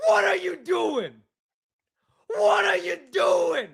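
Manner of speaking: put-on high shouted New York accent, slow and outraged; mock outraged New York sports caller; New York caller rant. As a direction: scream like a betrayed Giants fan on the radio. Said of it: "That's a good accent".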